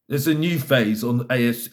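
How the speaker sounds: ordinary talk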